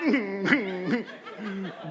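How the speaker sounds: re-enacted muffled giggling; imitated inappropriate giggle; stifled giggle. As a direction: try to hold in a giggle. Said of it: "I start giggling over here".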